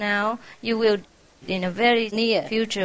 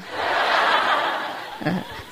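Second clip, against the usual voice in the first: breathy laugh; 4 semitones lower than usual. Real, not acted. Laugh between lines.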